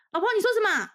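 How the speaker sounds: high, loud yell outward; loud call out. Retold or imitated. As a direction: shout to fake calm. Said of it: "往外大喊说".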